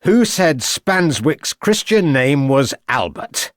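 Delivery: slow, pointed triumphant question; firm declaration. A narrator's reading. Inspector to prisoner, slow pointed gotcha. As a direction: slam it down like a fist on the table. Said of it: "declared Ravenscroft".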